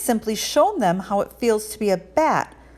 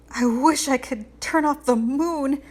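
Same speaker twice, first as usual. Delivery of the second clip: shivering; 6 semitones higher than usual. A narrator's reading. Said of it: "he said with a shiver".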